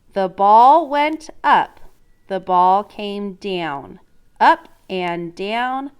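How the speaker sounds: slow, loud storybook reading; dramatic read-aloud. Acted. Teacher reading the ball's bounce aloud to the class, slow and loud.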